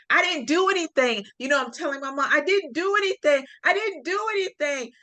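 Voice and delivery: high, pleading, insisting on innocence; defensive panicked plea. Retold or imitated. Scared girl pleading innocence to her mom on the phone, high and insistent. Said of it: "I'm on a defense".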